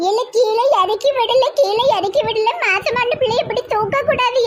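half-laughing alarmed scold. Acted. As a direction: tell him to put her down, alarmed. Mother-in-law scolding son playfully.

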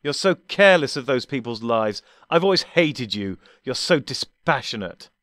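high, loud furious outburst; loud enraged outburst; exploding. Acted. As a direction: explode at him in rage. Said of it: "the doctor exploded".

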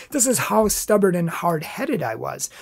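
usual speaking voice

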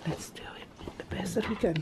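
soft hushed whisper; quiet at night. Real, not acted. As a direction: whisper it, someone's asleep. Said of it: "I'm talking very low".

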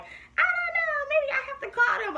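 acting clueless so the middleman buys it; feigned innocence. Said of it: "trying to pretend like".

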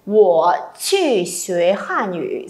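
"wo qu xue Hàn Yu" read very slowly, syllable by syllable, Mandarin tones clear; slow model sentence. Shown as a demonstration. Teacher reads "wo qu xue Hàn Yu" slowly for students to repeat. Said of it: "cả câu này sẽ đọc là".

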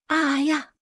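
high sharp cry of pain; sudden hurt yelp. A narrator's reading. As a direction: yelp as the salt water stings. Man screams in pain.

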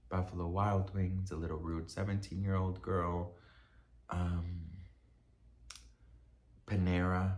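lost her train of thought. Real, not acted. Vlogger forgetting her point, quiet confused mumbling. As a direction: think aloud to yourself. Lost track, muttering to herself.

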